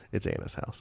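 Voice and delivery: unvoiced muttered aside; whispered aside. Real, not acted. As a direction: whisper it under your breath. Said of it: "muttered correction, wife's house".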